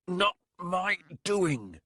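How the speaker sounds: slow, high snarled denial. A narrator's reading. Warlock denying blame to the captain, slow high snarl. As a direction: spit out the denial, drawn out. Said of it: "something like a snarl".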